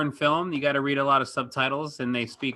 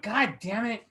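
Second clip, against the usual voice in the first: mock exasperation; 7 semitones higher than usual. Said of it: "I was like".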